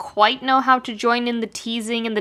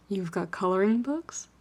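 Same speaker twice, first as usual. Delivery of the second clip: softer, close to his ear; hushed question; 8 dB quieter than usual. A narrator's reading. Shiro leaning close to Keith in the booth, hushed question. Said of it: "Shiro ducks closer before asking".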